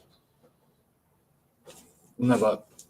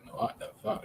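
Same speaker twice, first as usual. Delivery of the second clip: whispered curse; 16 dB quieter than usual. Real, not acted. Frustrated with YouTube search.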